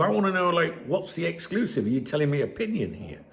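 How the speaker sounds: ordinary delivery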